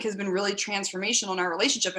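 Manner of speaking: ordinary talk, nothing marked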